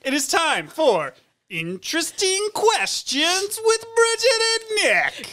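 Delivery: slow, high game show announce; announcer voice. Pretend. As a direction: boom it out like a host. Announcing the segment title.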